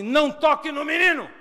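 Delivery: full-force, high-pitched command; authoritative shout. Retold or imitated. Small mother shielding her son from the crowd, commanding shout. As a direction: yell it to stop a whole crowd. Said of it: "Ela deu uma autoridade".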